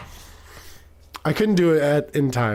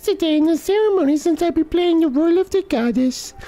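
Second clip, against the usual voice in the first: put-on much higher, girlish and sweet morning greeting; a cheerful young girl greeting her friend, pitched way up; Zelda voice. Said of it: "That's how I think of girls when they talk".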